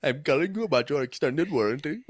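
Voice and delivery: put-on Indian accent, a laugh in it; mock Indian telemarketer accent. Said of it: "Indian, one of those fucking telemarketers".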